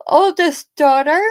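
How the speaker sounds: rising to a loud, high "Woo!"; excited whoop. Real, not acted. Mother showing her daughter's photo to viewers, excited whoop.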